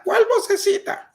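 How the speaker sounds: ¿Cuál vocecita? con un salto muy agudo y fuerte; asombro exagerado. Pretend. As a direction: finge no entender, con un chillido de sorpresa. Imita la vocecita interior. (pregunta incrédula, muy aguda)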